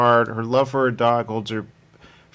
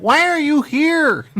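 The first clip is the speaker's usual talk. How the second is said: suspicious demand; 14 semitones higher, 5 dB louder, slower than usual. Retold or imitated. Voicing the hostile subject.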